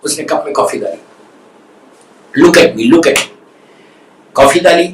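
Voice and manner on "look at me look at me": ordinary talk, nothing marked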